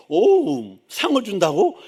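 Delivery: high, loud mock delight; playfully eager exclamation; acted anticipation. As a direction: imitate a parent thrilled by a promised prize. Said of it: "막 그렇게 속으로는 기대가 돼?".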